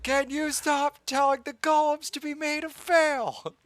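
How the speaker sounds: squeaky thrall voice. Retold or imitated. Thrall complaining to the player about the golem, high and squeaky. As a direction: whine it in a tiny high voice. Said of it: "It's like".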